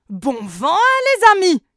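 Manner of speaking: very loud, high hearty cheer; hearty shout. A narrator's reading. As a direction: shout a big friendly farewell. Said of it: "s'écrie le corrigan gros bras". (le corrigan costaud crie un au revoir)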